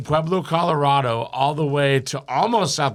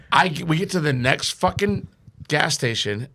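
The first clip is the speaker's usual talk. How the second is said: ordinary delivery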